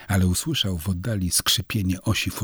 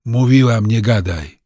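sharp, louder scolding; scolding snap; 4 semitones higher, 8 dB louder than usual. A narrator's reading. Aunt snapping at nephew, sharp scold. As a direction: snap at the boy to shut up. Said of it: "ofuknęła go".

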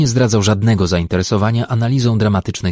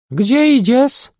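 very high, loud lisping squeak; man squeaking in a mock wife's voice; 4 dB louder, slower than usual. A narrator's reading. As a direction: squeal it with a lisp like a housewife. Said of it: "zapiszczał sepleniąco".

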